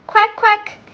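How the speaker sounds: high little duck quacks. Acted. Showing children what the toy duck says, high quacking. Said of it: "it is saying".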